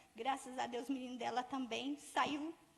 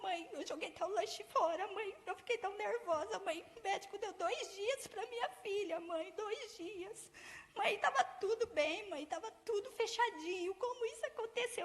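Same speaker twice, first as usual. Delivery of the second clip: higher, tearful retold plea from the daughter; daughter crying; 6 semitones higher than usual. Daughter's desperate call, retold. (filha chorando, voz mais aguda)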